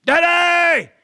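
loud, high calling out across the house; child calling for dad. Retold or imitated. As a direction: holler for Dad like a kid searching every room. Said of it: "he's screaming the same thing".

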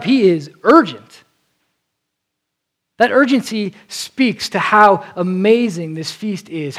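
ordinary delivery

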